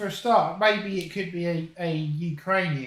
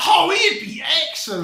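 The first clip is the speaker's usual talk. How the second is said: loud, high burst of enthusiasm; excited shout; 9 semitones higher, 7 dB louder than usual. Real, not acted. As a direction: cheer the idea. Excited about Paddy McGuinness.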